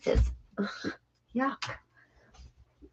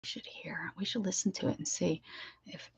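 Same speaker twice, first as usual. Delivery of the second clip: hushed conspiratorial whisper; 3 semitones lower, 15 dB quieter than usual. Real, not acted. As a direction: whisper it like a secret plan. Secretive about the voicemails.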